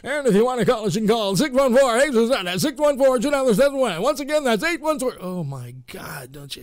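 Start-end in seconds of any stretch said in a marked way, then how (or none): 0.0-5.2 fast, loud, higher hard-sell radio announcer. Retold or imitated. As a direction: rattle off the phone number like a pushy radio ad. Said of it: "don't you hate those kind of commercials".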